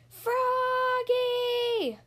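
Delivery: high, drawn-out sung call; yelled. A narrator's reading. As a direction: call it across the field. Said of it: "yelled the coach".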